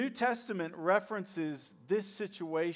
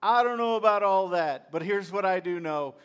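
loud preaching; 3 semitones higher, 9 dB louder than usual. Acted. Preacher answers the skeptic, booming proclamation. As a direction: shout the creed with full force.

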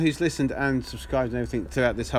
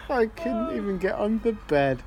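higher, slow, weak with laughter; laughing punchline repeat; 6 semitones higher, slower than usual. Real, not acted. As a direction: savour the punchline while cracking up. Repeats punchline while cracking up.

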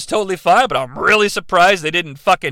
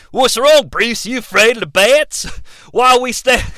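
hammed-up Aussie father; broad, badly wandering Australian impression; Australian accent; 3 semitones higher than usual. Bruce Wayne's dad talking to his son, exaggerated Australian accent. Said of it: "this poor dad's accent is all over the place".

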